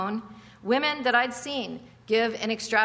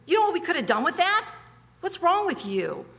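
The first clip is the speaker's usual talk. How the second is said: self-righteous scoff; 8 semitones higher than usual. Retold or imitated. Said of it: "can you hear him?".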